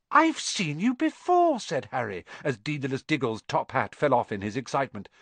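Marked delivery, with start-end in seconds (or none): 0.1-1.6 put-on high, slow shy boy; young boy voice. A narrator's reading. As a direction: say it like a timid boy. Harry's voice, high far.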